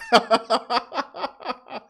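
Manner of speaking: high, long laugh; hearty laugh. Real, not acted. Host recalling an angry caller, hearty laugh. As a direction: crack up at your own story. Amused by pissed-off caller story.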